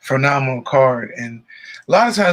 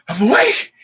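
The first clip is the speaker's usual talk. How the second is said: high, loud, relieved "I'm awake"; dad waking up alive, a high relieved cry; 10 semitones higher, 4 dB louder than usual. Retold or imitated. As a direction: gasp it out in disbelief. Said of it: "He was like".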